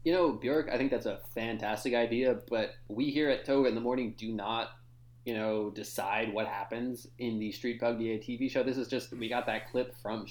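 low, booming show host; radio host voice. Acted.

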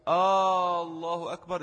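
long drawn-out opening آه in آه الله أكبر; مد الهمزة. Shown as a demonstration. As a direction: stretch the first vowel of آه before الله أكبر. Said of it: "استفهام". (مدّ همزة «آه» في أول التكبير)

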